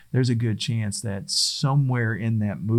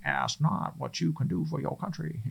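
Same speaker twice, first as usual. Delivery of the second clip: higher, soft bad Boston accent quote; hammed-up Boston accent, softly pitched up; terrible Boston accent; 6 semitones higher, 6 dB quieter than usual. Speaker imitating JFK at the microphone, terrible Boston accent. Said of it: "that's my terrible, uh, Boston accent".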